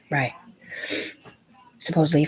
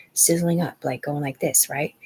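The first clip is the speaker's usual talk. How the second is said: ordinary talk, nothing marked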